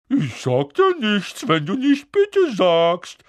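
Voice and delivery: high, slow, loud teasing lilt; Peeves, annoying singsong. A narrator's reading. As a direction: needle him in a drawn-out poltergeist singsong. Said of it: "mit einer nervigen Singsangsstimme".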